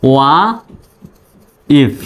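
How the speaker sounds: ওয়া, long pause, then ইফ; teacher splitting "wife" into ওয়া ... ইফ; broken into sounds. Shown as a demonstration. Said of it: "এখানে হবে ওয়াইফ".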